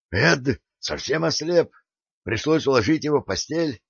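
Louis bursting to tell it; excited. A narrator's reading. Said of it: "он буквально подпрыгивал от возбуждения".

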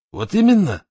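high, loud irritated retort. A narrator's reading. Raduev retorting to Berezovsky about the television, irritated. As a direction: snap it back, annoyed. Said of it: "раздражённо сказал Радуев".

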